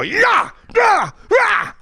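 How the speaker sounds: very high yelling yeahs; screaming head off. Shown as a demonstration. Boxer taking body shots, screaming very high. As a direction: scream it out high every punch. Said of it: "I scream my head off".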